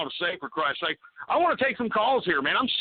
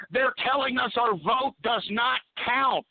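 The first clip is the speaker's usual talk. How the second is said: ordinary talk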